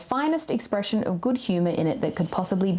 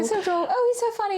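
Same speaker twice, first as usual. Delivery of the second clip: high, airy mock wife voice; silly wife impression; 13 semitones higher than usual. Mocking Charlotte gushing about her rude husband, high and giddy. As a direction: play the clueless wife who adores him. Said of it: "she just goes".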